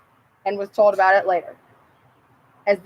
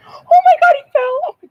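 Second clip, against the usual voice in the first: real shriek; 5 dB louder than usual. Real, not acted. Frog falls while being picked.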